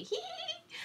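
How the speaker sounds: very high silly tittering; foolish giggle. Acted. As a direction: giggle like a fool. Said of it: "giggling foolishly".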